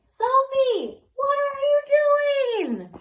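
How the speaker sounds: high, loud call across the yard; dad calling out. A narrator's reading. Dad calls to Sophie from afar, loud and high. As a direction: shout it out like a dad calling from the house. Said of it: "she heard her dad call".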